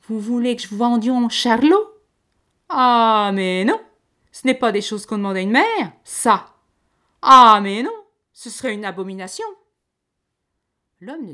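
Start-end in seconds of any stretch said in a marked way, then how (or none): 0.1-9.5 much louder and higher, outraged country mother; furious outburst. A narrator's reading. Farm wife furious at the lady's offer, shouting. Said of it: "toute furieuse".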